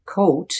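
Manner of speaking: "coat" with a long oh vowel; hard O. Shown as a demonstration. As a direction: round "coat" into a clear oh. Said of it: "has a hard O".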